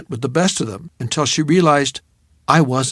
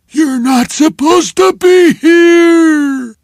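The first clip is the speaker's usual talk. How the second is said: high, loud, slow yell; furious shout; 11 dB louder, much slower than usual. Retold or imitated. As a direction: bellow at the intruder. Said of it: "he had said".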